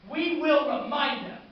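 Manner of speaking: slow, high, full shout; climactic battle vow. Acted. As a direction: roar it out, stretching each word. Refrain at its climax.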